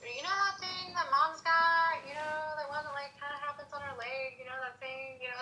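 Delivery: put-on hesitant child asking her dad; little girl again. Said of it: "It was like I was seven years old again".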